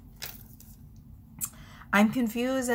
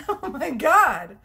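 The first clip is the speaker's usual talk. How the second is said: laughing; 7 semitones higher, 7 dB louder, slower than usual. Real, not acted. Laughing fit over the cards.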